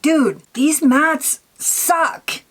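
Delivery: high, drawn-out mock exasperation; retold complaint. Vlogger re-enacting her verdict on bad mattes, exasperated. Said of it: "I was like".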